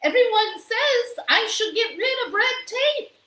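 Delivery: high, bright-eyed character voice; the maid proudly explaining to the mayor, high and loud; Amelia Bedelia. A narrator's reading. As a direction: say it high and cheerful. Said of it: "said Amelia Bedelia".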